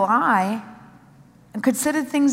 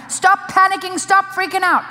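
louder and higher, sharply ordering calm; forceful rebuke; 7 semitones higher, 5 dB louder than usual. Acted. Preacher to congregation, a loud stern stop-panicking command. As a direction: bark it at a panicking crowd.

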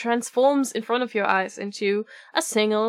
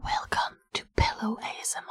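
ASMR whisper; 7 dB quieter than usual. Pretend. Jokes about ASMR whisper.

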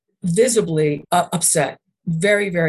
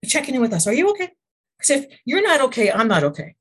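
high, anxious checking-in voice; a caring inner voice asking if all is well; brainstem checking in; 5 semitones higher, faster than usual. Retold or imitated. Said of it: "looking at the heart and going".

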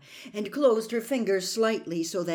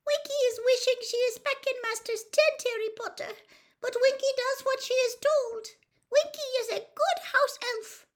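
very high, loud plaintive elf voice; Winky's squeak; 5 dB louder than usual. A narrator's reading. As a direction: squeak it dutifully as a timid house elf. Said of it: "quivering squeak of a voice".

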